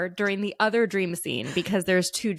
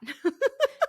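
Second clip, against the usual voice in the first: short, high rising laugh; a pitched-up giggle. Real, not acted. Laughing at mom's reply, high giggle.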